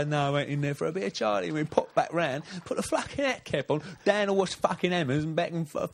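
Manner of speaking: high-pitched, over-the-top Cockney geezer; London accent. Acted. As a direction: do a badly faked London accent, pitched right up. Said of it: "can you do a London accent".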